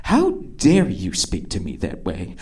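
low, quiet mock outrage; soft, low, imitated indignation; hushed indignation. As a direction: play offended under your breath. Acting offended woman, first take.

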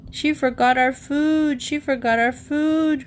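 high crowing call; crowing rooster. Acted. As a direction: crow it like a rooster. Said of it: "crowed Egbert".

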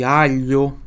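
Alho with a clear lh; lh sound demo. Shown as a demonstration. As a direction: stress the lh in Alho. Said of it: "G L I tem som de L H I". (Alho com lh bem marcado)

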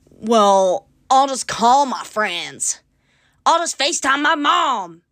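loud, drawn-out mock protest, rising high; mocking imitation of objectors. People answering her moving advice, mockingly imitated. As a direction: mimic people's comeback, loud and stretched out.

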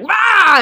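sudden high, loud "rah"; playful retold scare shout; scary roar. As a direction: roar to make people jump. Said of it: "like, scare people".

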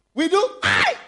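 a sudden high yelp; mock cry. Preacher showing how they cry out before falling. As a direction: yelp it out as if startled.

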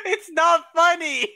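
high pitched, laughing through it; can't stop giggling while protesting. Real, not acted.

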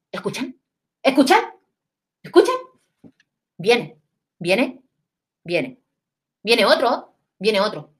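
imitando a una abuela con miedo, preguntas que suben; la abuela alerta y nerviosa; abuela asustada. Retold or imitated. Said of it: "Y mi abuela empieza". (abuela asustada en la noche del temblor, voz de alarma)

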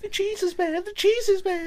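very high whiny mock quote. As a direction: mimic a whiny complaint in falsetto. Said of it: "declining that and saying".

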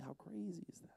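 unvoiced whisper of amazement; preacher marvelling to the congregation in a whisper; whispered wonder. Real, not acted. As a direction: whisper it in awe.

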